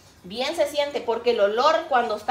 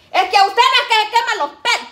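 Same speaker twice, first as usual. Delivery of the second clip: scolding; 10 semitones higher, 9 dB louder than usual. Retold or imitated. Mother scolding.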